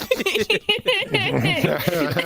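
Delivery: big burst of real laughter; hearty laugh. Real, not acted. Friends laughing over old pranks, hearty laugh. Laughing at his own story.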